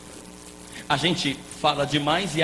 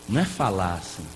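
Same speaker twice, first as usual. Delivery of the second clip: normal talking voice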